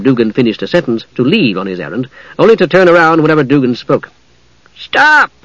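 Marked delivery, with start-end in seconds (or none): 4.8-5.3 very high gully dwarf cry; high-pitched creature's exclamation; sudden shout. A narrator's reading. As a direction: squeal it out suddenly. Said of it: "the gully dwarf shouted suddenly".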